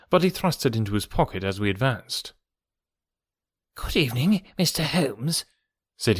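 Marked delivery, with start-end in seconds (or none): 3.8-5.4 mild reedy, uneasy greeting; lightly voiced nervous patient. A narrator's reading. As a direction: greet him with a touch of nerves.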